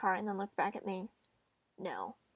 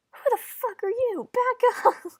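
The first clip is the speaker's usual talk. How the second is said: put-on high, loud scream of a startled, angry girl; furious scream; 12 semitones higher, 10 dB louder than usual. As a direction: yell it like you've just woken up to a stranger. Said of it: "More screaming I can't do".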